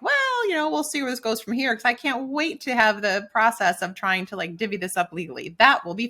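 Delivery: mock-cheerful sarcasm about divorce; sarcastic mock thought. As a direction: tease the idea with a lilting sarcastic voice. Sarcastic imagined newlywed thoughts.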